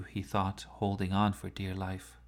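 ordinary delivery, nothing marked

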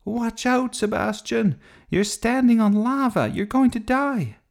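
put-on high, urgent child's shout; shouted warning. A narrator's reading. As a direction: yell a warning of deadly danger. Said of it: "Sophie shouted".

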